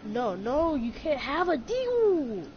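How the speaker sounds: usual speaking voice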